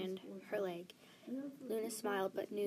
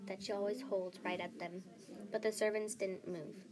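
ordinary delivery

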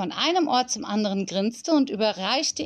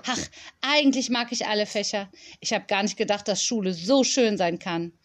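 ordinary delivery, nothing marked

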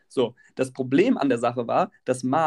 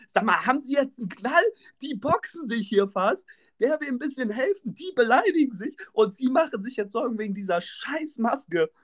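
higher, exasperated telling-off; incredulous outrage; 8 semitones higher than usual. Retold or imitated. Helper confronting the mask-obsessed passenger, incredulous. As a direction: protest in disbelief at the absurd priority. Teller's incredulous retort to Ute.

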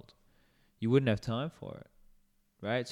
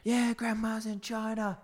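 echoing call; 11 semitones higher, slower than usual. Retold or imitated. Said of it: "try and echo my voice around the corner".

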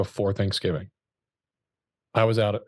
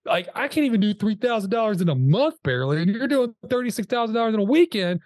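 high, incredulous retold rant; half-joking outrage at his numbers; exasperated disbelief; 11 semitones higher than usual. Retelling pleading with the top producer, high and incredulous.

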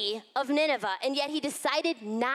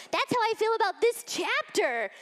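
higher, pointed quip about the chapter; emphatic quip; 8 semitones higher than usual. Retold or imitated. Teacher jokes to her group about disliking the chapter, pointed high quip. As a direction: toss it off with a knowing edge. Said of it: "I'm like".

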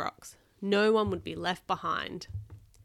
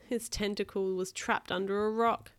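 usual speaking voice

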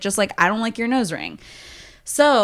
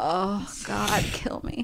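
softer, fading to a breathless whisper; muttered despair; 2 semitones lower, 10 dB quieter, much slower than usual. Retold or imitated. Reacting to a date ordering Riesling, muttering quietly. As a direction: mutter it under your breath. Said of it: "I was just like".

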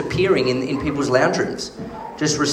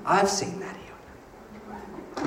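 slow breathy whisper of faith; 2 semitones higher, 13 dB quieter, much slower than usual. Shown as a demonstration. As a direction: whisper it slowly, in awe. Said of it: "I'm like".

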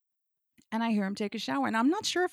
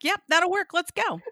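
louder, higher, upbeat agreement; eager go-ahead; 9 semitones higher, 8 dB louder than usual. Retold or imitated. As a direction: act out a game, let's-get-on-with-it yes. Eager assent acted in the story.

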